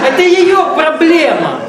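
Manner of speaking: high, slow words through laughter; laughing it out loud. Real, not acted. Laughs at the lady's tail.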